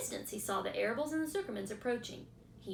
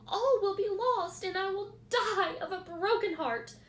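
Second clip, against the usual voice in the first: higher, louder pleading; desperate plea; 11 semitones higher, 7 dB louder than usual. A narrator's reading. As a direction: pig begging for help. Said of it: "said Wilbur in desperation".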